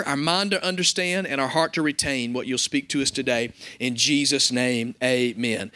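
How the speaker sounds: hushed congregational prayer. Acted. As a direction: pray it humbly before the sermon. Pastor leads opening prayer.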